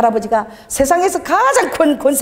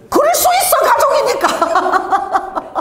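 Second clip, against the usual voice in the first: high, laughing, drawn-out reply; demonstrated answer; much slower than usual. Shown as a demonstration. Preacher modelling the reply for the congregation, laughing high exclamation. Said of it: "한번 해보세요, 여러분이".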